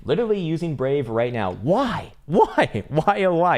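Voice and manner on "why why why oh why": mock despair. Real, not acted. Viewer uses Brave browser.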